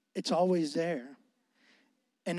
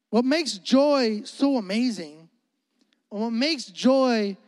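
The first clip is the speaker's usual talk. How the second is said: joy drawn out slowly and louder; stress on joy; 2 semitones higher, 3 dB louder than usual. Real, not acted. Preacher dwelling on the word, slow and emphatic. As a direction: lean hard on joy, slowly. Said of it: "repeated phrase stressing joy".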